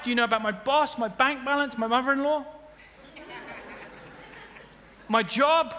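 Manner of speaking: a churchgoer complaining loudly to God about life; exasperated protest. Acted. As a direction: play the overwhelmed believer arguing with God. Acting a worried believer protesting.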